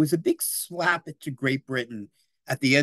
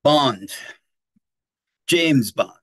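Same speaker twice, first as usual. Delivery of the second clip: Bond catchphrase; 6 dB louder, much slower than usual. Retold or imitated. Quoting the famous Bond line.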